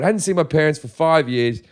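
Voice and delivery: ordinary talk, nothing marked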